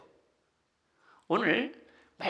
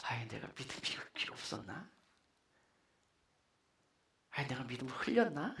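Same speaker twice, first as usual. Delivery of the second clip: hushed, low embarrassed mumbling to oneself; sheepish; 8 semitones lower, 12 dB quieter than usual. Acted. Disciples question their own faith after gentle rebuke, sheepish murmur. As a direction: wonder aloud softly, abashed. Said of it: "제자들은 이렇게 좀 뒷머리를 긁었을 거예요. 교문쩍어가지고".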